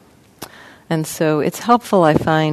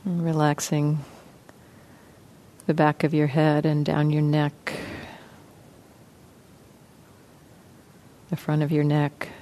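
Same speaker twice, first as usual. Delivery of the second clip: soft, even voice with long pauses; guided meditation; 6 dB quieter than usual. Real, not acted. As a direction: gently guide listeners into relaxing, leaving space between phrases. Leads a guided body-scan meditation.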